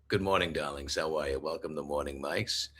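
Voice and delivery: low, soft-spoken New Yorker character voice; deep, low New York morning-host voice; Maurice from New York. Acted. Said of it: "my name is Maurice ... with this, uh, particular voice".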